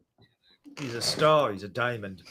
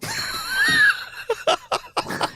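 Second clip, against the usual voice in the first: very high, loud shriek of laughter; 9 dB louder than usual. Real, not acted. Friend cracking up at the vodka joke, shrieking laugh. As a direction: howl with laughter, squealing.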